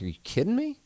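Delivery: a high, squeaky "are you kidding me"; incredulous. Retold or imitated. Telling a buddy about Tinder, high and disbelieving. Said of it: "And I'm like".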